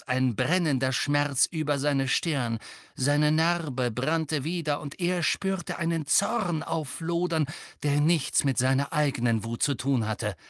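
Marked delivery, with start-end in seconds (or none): none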